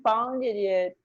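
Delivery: normal talking voice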